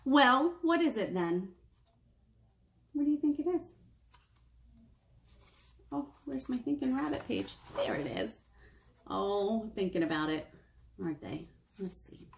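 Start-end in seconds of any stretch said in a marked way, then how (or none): none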